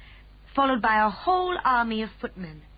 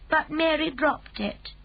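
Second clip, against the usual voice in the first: a lightly voiced calm Queen, higher; gracious royal command, a touch higher; 7 semitones higher than usual. A narrator's reading. Queen speaks.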